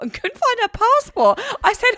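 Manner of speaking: ordinary talk, nothing marked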